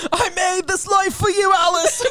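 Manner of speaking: a hint of a British accent, laughing; lightly put-on British accent. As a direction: owner teasing her cat in a hint of a British accent. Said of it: "started doing a British accent".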